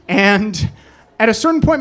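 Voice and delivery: usual speaking voice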